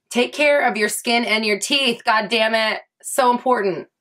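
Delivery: usual speaking voice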